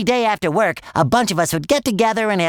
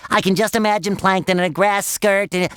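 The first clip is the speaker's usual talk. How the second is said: usual speaking voice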